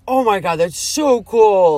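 slow, emphatic mock-amazed gush; thrilled retold reaction. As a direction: imitate awestruck college kids, stretching it out. Retells kids' thrilled reaction.

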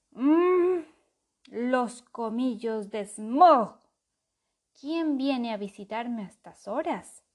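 loud, slow cavernous dragon voice; booming dragon waking up; grim reaper voice. A narrator's reading. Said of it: "una voz cavernosa".

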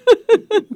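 loud burst of laughter; a big real laugh. Real, not acted. Host laughs at compliment.

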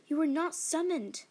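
high loud voice cracking with dread; a father defiant but frightened; trembling voice. Acted. Father facing the fiery spirit: high, loud, trembling. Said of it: "My father's voice trembled".

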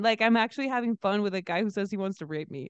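normal talking voice

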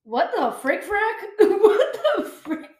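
high, drawn-out laughing exclamation; giggly, slow, high-pitched outrage; laughing disbelief. Real, not acted. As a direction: stretch it out through giggles, incredulous.

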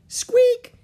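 very high, loud mouse squeak. A narrator's reading. Peanut greeting Jack and Annie, mouse squeak. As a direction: squeak like a tiny excited mouse.

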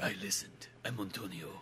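low, very soft mock-dramatic voice; hushed, deep character imitation; quiet movie-hero impression. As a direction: murmur it low like a brooding hero. Impersonating film hero's calm reveal.

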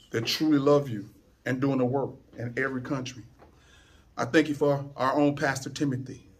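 low, softened praying voice; quiet thanks offered up to God; heartfelt prayer. Real, not acted. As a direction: pray gently, pausing between phrases. Leads the congregation in prayer.

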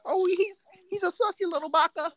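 very high, squeaky mocking voice; squeaky meme voice. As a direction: pitch it way up like an internet meme. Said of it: "You know how motherfuckers think nowadays".

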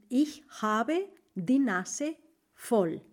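slow, clear German idiom; teacher modelling a German idiom; slow model phrase. Shown as a demonstration. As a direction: let each word land. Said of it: "slow idiom model".

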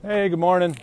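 high, loud friendly call across a yard. Real, not acted. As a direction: greet the neighbours from a distance. Calling out to neighbours outside.

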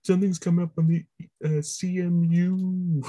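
dazed Electric Mayhem musician; stoned hippie voice. Retold or imitated.

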